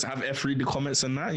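normal talking voice

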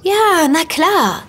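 a slow, high-pitched of course that waves the doubt away; drawn-out of course. Acted. Insisting to her friend that Dirk has a girlfriend, slow and emphatic. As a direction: stretch it out slowly, high and emphatic.

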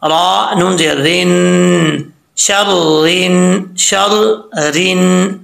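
slow, deliberate recitation; slow spelling recitation. Shown as a demonstration. Teacher slowly spells and recites the word. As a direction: sound it out slowly. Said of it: "कैसे?".

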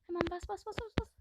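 high, rising urgent repetition; urgent urging. Real, not acted. Gamer urging herself to press jump, high urgent chant.

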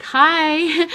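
high, put-on breezy "Hi."; mock cool greeting. Mocking mean chatters online, put-on greeting. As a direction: imitate a too-cool chatter. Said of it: "you're like".

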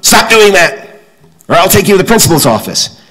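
high, loud scolding teacher voice; mock-stern teacher calling out a kid. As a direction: play the stern second-grade teacher. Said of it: "She said".

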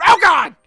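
sudden loud cry of pain; pained yelp. Real, not acted. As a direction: yelp out as you get hurt. Ragdoll falls, cries out.

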